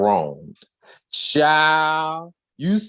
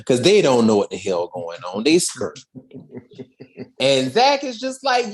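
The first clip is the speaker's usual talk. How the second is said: ordinary delivery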